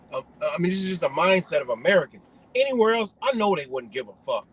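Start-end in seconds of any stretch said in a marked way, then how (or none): none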